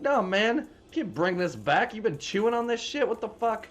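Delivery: high, put-on irritated voice; clerk refusing. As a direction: refuse the chewed-up CD.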